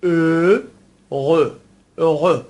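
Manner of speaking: "ا، غ" loud and split, both vowels lip-rounded; French pronunciation. Shown as a demonstration. Teacher repeats happy in French, "ا، غ" in separated syllables. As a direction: stretch "ا", pause, then throaty "غ".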